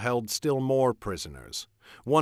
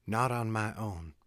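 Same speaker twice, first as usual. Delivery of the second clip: gently lowered volume, understated; soft; 6 dB quieter than usual. A narrator's reading. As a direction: say it softly, almost privately. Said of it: "she said softly".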